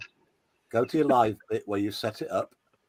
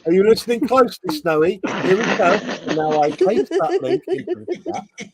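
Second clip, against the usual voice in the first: patronizing teacher; 7 semitones higher, 4 dB louder than usual. Pretend. Teaching Snowy to paste link.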